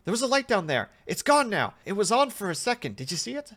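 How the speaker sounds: high, loud startled report; excited test subject. Acted. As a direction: call out urgently about a sudden sighting. Subject glimpses a light.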